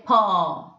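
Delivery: "paw" with a long held "aw", loud; prolonged O. Shown as a demonstration. Teacher giving the third example, prolonged O. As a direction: stretch the vowel in "paw". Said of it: "the prolonging of O".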